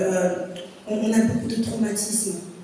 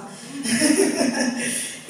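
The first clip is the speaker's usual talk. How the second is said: a short real laugh, mostly air; light unvoiced chuckle; 5 semitones higher than usual. Real, not acted. Audience laugh after her joke.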